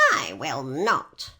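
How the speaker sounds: witch voice. A narrator's reading. Witch refuses Dorothy.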